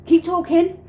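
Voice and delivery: high, loud mimicry; urgent calling. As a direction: imitate him calling out insistently. Acting Rob calling out.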